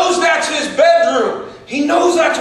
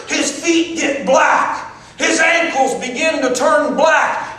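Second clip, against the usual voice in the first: ordinary delivery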